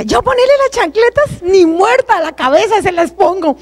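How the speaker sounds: high, indignant mock protest; a daughter scoffing at her mother's advice; scornful retort. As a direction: scoff at the very idea.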